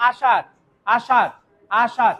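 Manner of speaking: আসার said "aashaar", tongue clear of the palate; retroflex sh. Shown as a demonstration. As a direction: repeat আসার apart, sh without tongue contact. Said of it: "মূর্ধন্য স্বরের উচ্চারণ সময় যেমন". (আসার-এ মূর্ধন্য ষ, জিভ ছোঁবে না)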